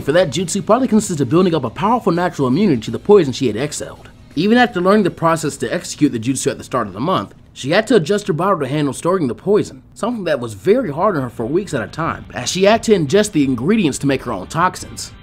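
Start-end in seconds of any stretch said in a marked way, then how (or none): none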